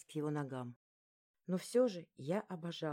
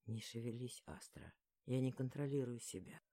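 low, soft, husky male voice; hoarse whisper; 6 semitones lower, 5 dB quieter than usual. A narrator's reading. Lexer warns Astra he can't control himself, hoarse whisper. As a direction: murmur it low and hoarse, barely holding back. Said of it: "Хриплый шепот выдавал его чувства".

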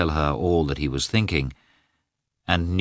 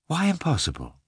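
high, fighting back tears; holding back tears; 11 semitones higher, 3 dB quieter than usual. A narrator's reading. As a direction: ask it through held-back tears. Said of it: "forcing back her tears".

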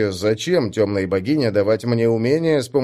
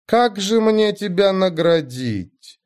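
mock thoughtful; 8 semitones higher, slower than usual. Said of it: "сделала вид, что задумалась богиня".

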